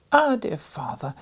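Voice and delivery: put-on high, bright girlish cry; a child calling out with delight; excited little girl. A narrator's reading. Maria greeting her father with a high excited cry. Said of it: "exclaimed Maria".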